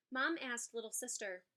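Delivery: usual speaking voice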